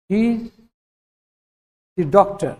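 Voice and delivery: "the" said as a short "thuh" before "doctor"; "the doctor" with a quick, reduced "the"; weak the. Shown as a demonstration. Teacher showing students how "the doctor" sounds. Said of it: "द डॉक्टर बोलना पड़ेगा".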